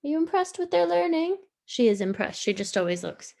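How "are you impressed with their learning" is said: pet talk. Real, not acted. Playfully asks the pet.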